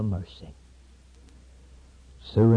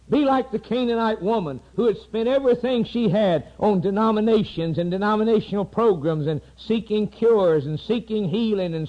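high, loud preacher's build; fiery preaching; 8 semitones higher, 4 dB louder than usual. Acted. Preacher telling of the woman, rising and loud. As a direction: drive the story home from the pulpit.

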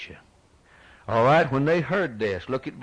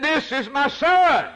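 high, loud, booming proclamation; voice of God; 7 dB louder than usual. Acted. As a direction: announce it as God from heaven. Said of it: "And he said".

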